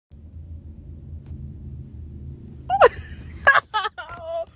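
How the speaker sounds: high breathless wheezing laugh; helpless laugh. Real, not acted. Laughing at the episode to her viewers, high wheezy laugh. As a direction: laugh it out, half in tears.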